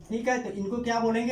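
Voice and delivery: usual speaking voice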